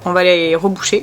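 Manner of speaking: normal talking voice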